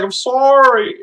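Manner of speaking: high, loud mock apology; apologetic Southern buddy. Buddy apologizing after falling with the shotgun, mock wail. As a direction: wail it out as the clumsy pal. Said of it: "He's like".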